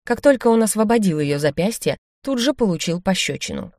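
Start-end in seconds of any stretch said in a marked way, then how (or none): none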